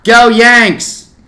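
loud, high mock cheer; jeering shout across the crowd; heckling yell. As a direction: holler a sarcastic team cheer.

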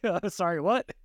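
a stranger baffled, laughing through it. Retold or imitated. Stranger reacting to the child, rising mock disbelief.

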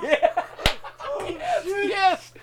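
high, giggling yeses; laughing yes. Retold or imitated. Teller recalls agreeing while cracking up, high and giggling. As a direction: squeak it through laughter. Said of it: "I was like".

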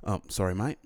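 put-on botched English accent that turns Australian; Australian-sounding slip. Bumping into a stranger, apologizing in an Australian-sounding accent. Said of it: "That sounded kind of Australian".

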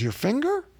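high, incredulous rising question; puzzled city man. Retold or imitated. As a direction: imitate a baffled greenhorn, voice jumping up.

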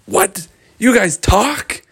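high, loud, drawn-out disbelief; astonished. Acted. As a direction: act out a stunned, high-pitched question. Boys amazed that unicorns talk.

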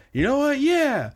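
put-on high-pitched drunken party cheer; rally everyone in a high, tipsy voice; excited, high voice of a merry drinker; tipsy enthusiasm. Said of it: "he's already a little bit drunk".

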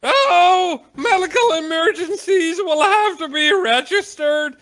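very high, loud, slow squeaky clerk; panicky clerk voice. Acted. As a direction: squeal it like a flustered little clerk. Clerk voiced by game master.

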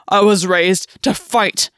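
rough, slow and loud. A narrator's reading. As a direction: growl it out roughly. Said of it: "his voice rough".

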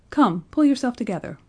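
forceful, no-nonsense reproof; commanding. A narrator's reading. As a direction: command him like a nurse. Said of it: "commanded the nurse".

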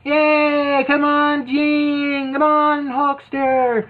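hammed-up fan cheers; mock cheering. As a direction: cheer them on from the sidelines. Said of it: "people there cheering, going".

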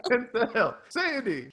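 high-pitched silly star impression; hammed-up high imitation; John Travolta. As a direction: call out in a goofy high celebrity voice. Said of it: "sound like John Travolta".

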